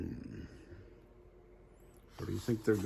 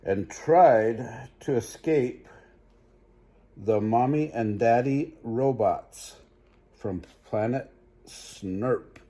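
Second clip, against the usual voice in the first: lively storybook read-aloud; animated bedtime story voice; reading to children; 3 semitones higher, 8 dB louder, slower than usual. Acted. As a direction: read it bright for little listeners. Reads picture book aloud.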